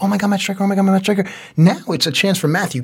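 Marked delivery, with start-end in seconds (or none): 0.0-1.3 starstruck fans. Retold or imitated. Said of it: "where everyone's like".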